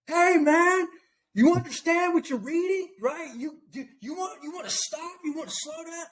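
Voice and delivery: mock-shout after the chariot, then drop to a coaxing hush; playful Philip voice. Philip chasing the Ethiopian's chariot, calling out in a high playful voice.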